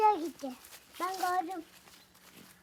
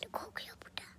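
whispering while hiding; 19 dB quieter, much faster than usual. Real, not acted.

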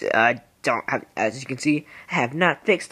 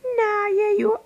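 much higher, squeaky protest. Retold or imitated. Teen gamer protesting to adolescence in a squeaky voice. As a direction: squeak it out like a cartoon kid. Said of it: "they're like".

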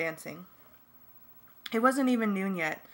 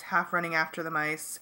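ordinary delivery

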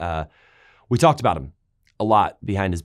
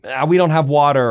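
whining; 3 semitones higher, 6 dB louder than usual. Retold or imitated. People complaining.

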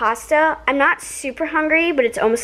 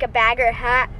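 ordinary talk, nothing marked

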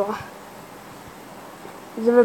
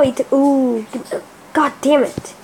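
normal talking voice

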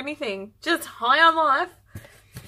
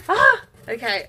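normal talking voice

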